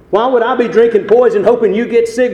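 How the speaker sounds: loud, high rhetorical question; full-force preaching. Real, not acted. Preacher challenging the logic of grudges, full-force preaching. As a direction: fire the question out at the congregation.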